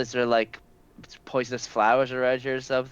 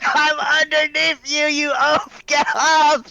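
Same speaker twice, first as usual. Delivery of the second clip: high, loud irritated shout; exasperated yell; 5 dB louder, slower than usual. Acted. Trapped under the table, exasperated yell. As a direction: yell up at the oaf on top of you.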